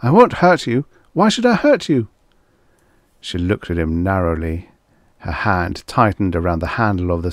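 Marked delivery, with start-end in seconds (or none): 0.0-2.1 high-pitched, pained appeal; pained pleading. A narrator's reading. Man tries to reassure the frightened girl, pained. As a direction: plead with her, voice climbing higher. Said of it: "he said painfully".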